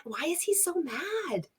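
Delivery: quietly baffled, half to herself; soft puzzled question to the viewers; baffled murmur. Real, not acted. Baffled at the angry clip.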